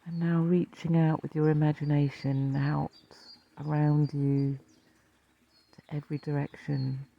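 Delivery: calm meditation teacher, leaving space to breathe; guided meditation. Acted. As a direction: lead listeners gently into rest, phrase by phrase.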